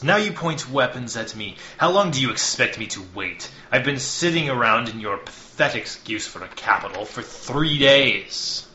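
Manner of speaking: loud, contemptuous envoy's voice, rising on the last words; arrogant envoy. Acted. Envoy Hawthorne scolding the chamber speaker, loud and scornful. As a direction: sneer down at the speaker from on high.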